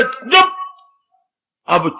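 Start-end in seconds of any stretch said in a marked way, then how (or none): 0.0-0.6 sharp hushing. Acted. Father silences child.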